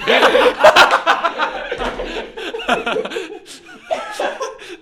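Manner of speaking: a long loud burst of laughter; loud laughing fit. Real, not acted. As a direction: lose it completely. Cracks up at the review.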